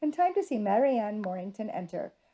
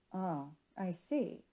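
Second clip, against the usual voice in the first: quiet, slow and sober; 3 semitones lower, 9 dB quieter, much slower than usual. A narrator's reading. Gentleman sobering at her news of a cold, slow and quiet. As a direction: let the cheer drain out, slowly. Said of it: "He sobered".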